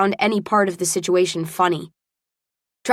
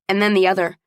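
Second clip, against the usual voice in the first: plain reading voice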